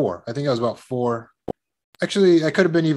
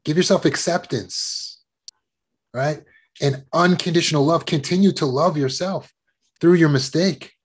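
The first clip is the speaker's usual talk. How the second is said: ordinary talk, nothing marked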